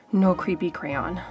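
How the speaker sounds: ordinary delivery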